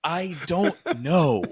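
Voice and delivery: drawn-out mock-innocent answer with a laugh; playing dumb. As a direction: drag it out as if clueless.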